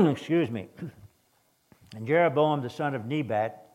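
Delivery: ordinary talk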